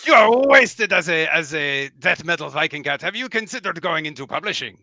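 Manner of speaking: animated, French-lilted gentleman author; French-accented mouse knight, enthusiastic. Acted. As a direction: flatter a fellow writer in a theatrical French accent. Mouse knight talks of publishing.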